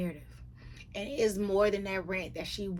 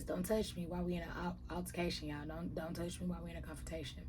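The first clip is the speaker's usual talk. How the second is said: fast, much softer mock complaint; rushed mock protest; 9 dB quieter, faster than usual. Host mocking Heather's protest, fast and soft. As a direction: rattle it off under the breath.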